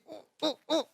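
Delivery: high, hushed mock yelps of pain; stifled pained cry. Host demonstrating pain swallowed so nobody wakes, stifled cry. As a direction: act out pain held back, softly. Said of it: "vous étouffez un cri de douleur".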